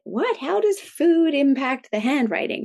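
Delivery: sharp, high startled "What?" then a slow, puzzled question; high and slowed, amazed; shocked disbelief. Retold or imitated. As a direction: retell your own stunned reaction. Mother's shocked reply to teacher.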